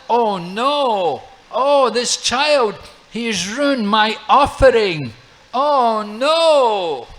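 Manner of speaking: high, loud, slow lament of dismay; dismayed wail. Acted. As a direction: cry out in horror over the spoiled offering. Brahmana dismayed at ruined offering.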